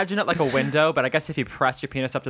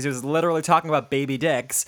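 ordinary talk, nothing marked

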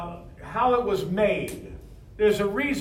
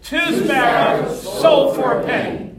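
louder, slower, full conviction; 6 semitones higher, 10 dB louder, slower than usual. Shown as a demonstration. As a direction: say it like you truly believe it. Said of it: "Say it like you believe it".

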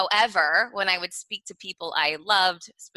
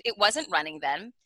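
usual speaking voice

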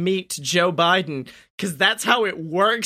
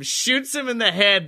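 ordinary delivery

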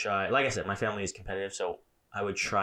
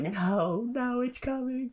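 high squeaky mock panic; mock dread; much slower than usual. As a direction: squeal in fake terror. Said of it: "was like".

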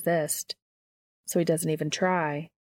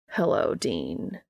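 deep, slow angel greeting; low and unhurried, drawing out hello; a slow deep voice, letting hello rise; Cass voice; 10 semitones lower, slower than usual. A narrator's reading. Angel greets Dean, narrated scene.

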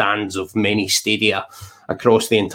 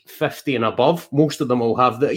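ordinary talk

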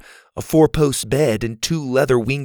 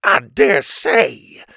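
slow, louder startled exclamation; drawn-out startled cry; exclaiming; 2 semitones higher, 5 dB louder, much slower than usual. Acted. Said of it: "Pa exclaimed".

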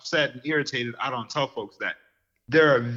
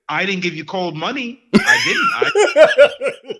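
much higher and louder, outraged; indignant outburst; 10 semitones higher, 9 dB louder, slower than usual. Real, not acted. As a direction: fire it back like you've been cheated. Indignant rant about cold food.

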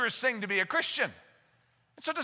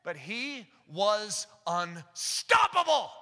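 ordinary delivery

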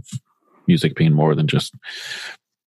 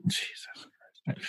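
muttered curse; 17 dB quieter than usual. Real, not acted. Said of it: "stumbling over the word".

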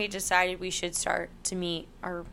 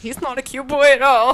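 high, loud, put-on voice; startled retort; 5 semitones higher, 9 dB louder than usual. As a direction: back off fast from the growl. Retreating from the growling dog.

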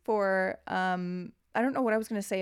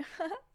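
light laugh; 10 semitones higher, 7 dB quieter than usual. Real, not acted. Laughs at own joke.

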